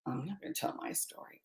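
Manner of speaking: breathy near-whisper of private thought; hushed inner monologue, not meant to be heard. Retold or imitated. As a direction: mutter it to yourself under your breath. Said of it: "I'm thinking in my head".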